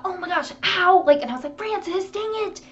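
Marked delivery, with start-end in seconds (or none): 0.0-1.0 higher-pitched mock cry of pain; retold pained exclamation. Retelling her stubbed-toe cry. 1.6-2.6 high-pitched scolding, held back in volume; mock yelling. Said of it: "yelling at her".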